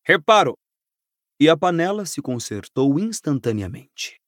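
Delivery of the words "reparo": firm spell, pitched up; firm command. A narrator's reading. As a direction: cast it as a crisp order. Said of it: "ordenou com firmeza".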